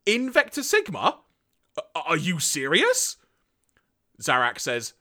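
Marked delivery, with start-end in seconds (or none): 0.0-3.2 high-pitched, stunned question; acted incredulity. Galvatron learning where the bomb is, incredulous. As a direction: repeat it back in hammed-up disbelief.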